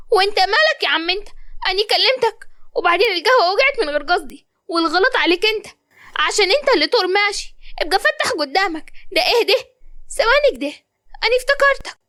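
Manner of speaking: put-on high, pert young woman talking back; sassy girl. Malak answers the stranger who bumped her, high and pert. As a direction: give him attitude right back.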